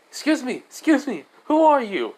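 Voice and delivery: high, eager calling voice; mock reporter clamouring with a question; pressing reporters. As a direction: call out for attention, pitch way up.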